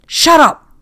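sharp loud high shout; angry shout. A narrator's reading. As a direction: bark at him to shut up. Captain silencing him again.

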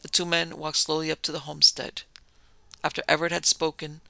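ordinary delivery

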